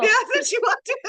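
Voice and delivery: laughing and high. Real, not acted. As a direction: giggle through the words. Laughing retelling.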